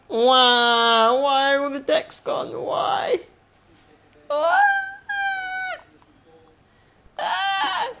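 high, hammed-up fake sobbing; mock wailing. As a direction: wail like a child over lost toys. Mock crying over decks.

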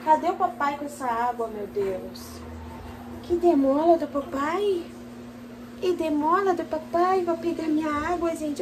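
put-on soft, high, slow baby talk. Mother talking softly to her newborn daughter, high baby talk. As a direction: coo gently to the newborn.

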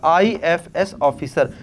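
"IFS officer" drawn out at half speed; slow emphatic repeat. Real, not acted. Teacher repeating a term for students to remember, slow and deliberate.

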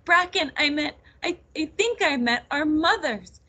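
high, distressed outpouring; tearful. A narrator's reading. Nettle tells her cousin about their mothers, tearful. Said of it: "she burst into tears".

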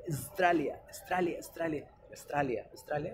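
"Australia" as hissed "Stralia", no Au; starting on S. Shown as a demonstration. Teacher drilling the S start, "Stralia". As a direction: begin "Australia" straight on the S, l kept. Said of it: "solamente con la S".

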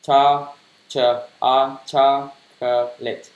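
"chocolate" stretched out syllable by syllable, slowly; slow reading. Shown as a demonstration. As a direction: drag "chocolate" out slowly for learners. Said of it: "Đọc chậm là chocolate". (đọc chậm từng âm "chocolate")

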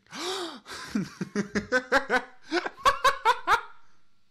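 loud gasp. Real, not acted. Streamer gasps at the crowd.

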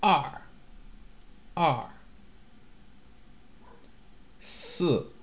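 short, sharp fall on "二" and "四"; falling fourth tone. Shown as a demonstration. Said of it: "have the fourth tone".

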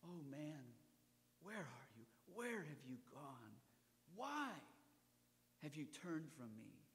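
lightly voiced grieving God; God's plea. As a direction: lament to lost mankind. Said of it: "God called out".

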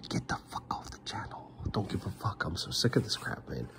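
harsh under-the-breath whisper, no voice; whispered swearing. Real, not acted. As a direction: hiss the curse off to the side. Angry aside muttered under breath.

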